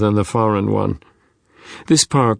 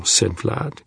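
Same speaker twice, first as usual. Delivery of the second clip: ordinary delivery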